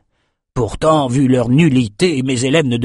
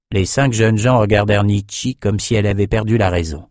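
plain reading voice

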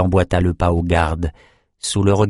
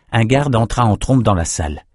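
plain reading voice

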